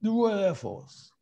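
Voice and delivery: high, drawn-out impersonation; slow mock pronouncement in a commander's put-on voice; Prince Philip. Commander repeating what was whispered to him, slow impersonation. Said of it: "he, he went".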